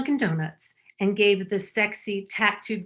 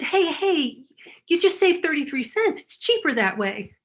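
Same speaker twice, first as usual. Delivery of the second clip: lightly voiced upbeat guy, higher; cheerful barista; 7 semitones higher than usual. Comic quoting the tattooed counter guy, lightly raised and upbeat. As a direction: brush it off brightly like the counter guy. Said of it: "So he said".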